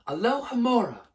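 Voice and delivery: usual speaking voice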